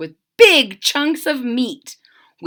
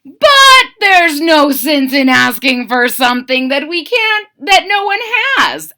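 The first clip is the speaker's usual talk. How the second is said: high, loud soldier grumble; 5 semitones higher, 7 dB louder than usual. Acted. Third soldier adds his complaint, loud and high. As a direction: raise the voice and press it. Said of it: "added the third".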